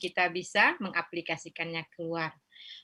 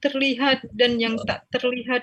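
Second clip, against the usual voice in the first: pleading prayer building from earnest to higher and louder; fervent prayer; 6 semitones higher, 12 dB louder than usual. Acted. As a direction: rise into an impassioned plea for forgiveness. Prayer rising to its climax.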